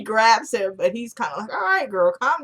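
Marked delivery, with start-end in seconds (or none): 1.5-2.4 higher-pitched offhand brush-off; lightly mocking, dismissive calm-down; unfazed. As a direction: imitate a man mildly telling her to settle down. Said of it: "he's not freaked out at all".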